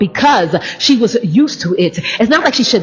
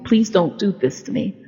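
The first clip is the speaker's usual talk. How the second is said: ordinary talk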